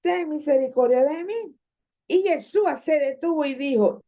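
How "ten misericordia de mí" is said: high, insistent plea; acted shout for mercy. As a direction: cry out higher, refusing to be silenced. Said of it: "pero él gritaba más y más".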